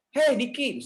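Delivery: put-on high, bright call to a child. Parent calling son coming home, affectionate high call. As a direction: sing out the child's name.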